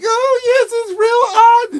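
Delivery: very high, loud, drawn-out mock gush; gushing internet voice. Internet reacting to the next big thing, mock high gush. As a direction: squeal it in a hammed-up high voice. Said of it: "the next big thing on".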